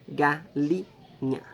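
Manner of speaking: "Galinha" as ga, li, nha, well spaced; syllable by syllable. Shown as a demonstration. Teacher locating the second syllable, "Galinha" slowly split. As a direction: pause after each syllable of "Galinha". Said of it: "Segunda sílaba".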